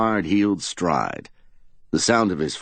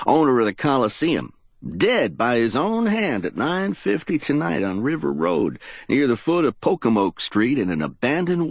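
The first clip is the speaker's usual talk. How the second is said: reporter phoning in a story; 9 semitones higher than usual. Acted.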